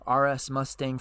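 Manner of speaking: ordinary delivery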